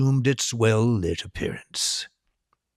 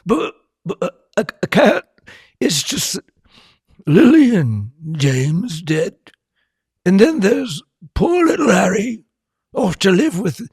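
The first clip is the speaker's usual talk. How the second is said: higher, louder tearful wail; sobbing giant; 12 semitones higher, 8 dB louder than usual. A narrator's reading. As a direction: break down crying as the giant. Hagrid crying over Potters.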